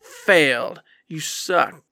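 usual speaking voice

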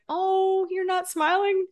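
high, drawn-out pouty complaint; mock hurt. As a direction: play up the needy disappointment. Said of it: "the part of me that wanted".

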